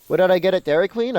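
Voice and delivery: ordinary talk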